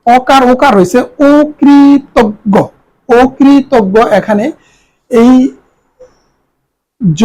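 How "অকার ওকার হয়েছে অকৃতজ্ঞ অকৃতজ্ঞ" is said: slow, careful অকৃতজ্ঞ with an o start; pronunciation demo. Shown as a demonstration. Teacher explains the o sound in অকৃতজ্ঞ, slow demo. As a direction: model অকৃতজ্ঞ as okritoggo, unhurried. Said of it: "এখানে রিকারের কারণে".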